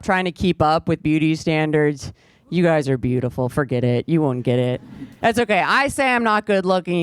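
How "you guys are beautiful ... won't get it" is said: usual speaking voice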